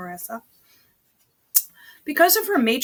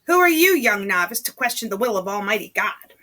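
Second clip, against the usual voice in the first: stern Mother Superior; 8 dB louder than usual. Acted. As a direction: scold the young novice from above. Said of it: "That's how Mother Superior talks".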